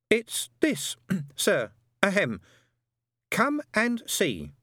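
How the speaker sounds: high, hesitant throat-clearing voice; nervous delivery man. A narrator's reading. Delivery man nervously inviting Death to look, high and hesitant. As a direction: falter and clear your throat, high and timid.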